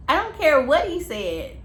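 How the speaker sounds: retold snap. Her own retold retort.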